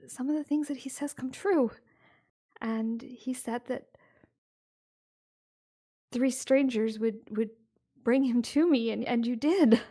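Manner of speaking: confiding, a little embarrassed; eager wife. Acted.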